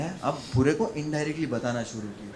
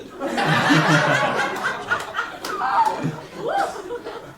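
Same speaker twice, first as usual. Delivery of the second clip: very high squealing laugh; real laughing fit; loud laughter; 3 dB louder than usual. Real, not acted. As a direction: lose it laughing.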